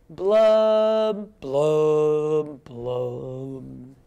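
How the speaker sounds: long blubs stepping down in pitch; gloomy fish blubs. Acted. Teacher leads children in the blub part, descending blubs.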